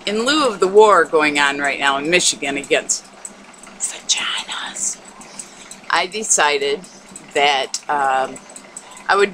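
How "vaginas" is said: hushed, drawn-out whisper; mock whisper. Announcing a war against a taboo word, mock whisper.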